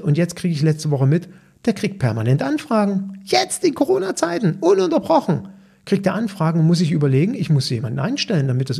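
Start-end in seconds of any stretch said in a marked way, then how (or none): 3.2-5.5 excited. Real, not acted. Marvels at constant client inquiries.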